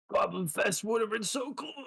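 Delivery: high, whiny goblin voice; dying goblin lament. Acted. Goblin leader answering his killer, whiny high lament. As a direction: goblin mourning his lost festival.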